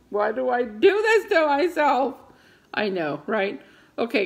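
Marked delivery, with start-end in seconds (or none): 0.1-2.2 wailing lament. Real, not acted. Laughing lament at her fear.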